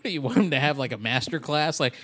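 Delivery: ordinary delivery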